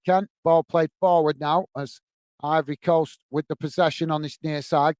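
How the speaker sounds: normal talking voice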